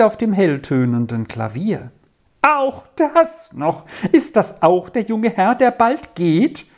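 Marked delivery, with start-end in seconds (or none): 2.4-6.7 agitated. Acted. Aunt complains to the landlady, high and agitated. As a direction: voice climbing in fretful outrage. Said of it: "fragte Tante Ninette in Aufregung".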